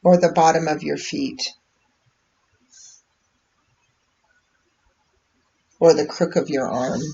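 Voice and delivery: unhurried meditation voice with long pauses; calm, measured guidance, leaving space between phrases; guided meditation. Real, not acted. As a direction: guide listeners gently inward, phrase by phrase. Leads a live meditation.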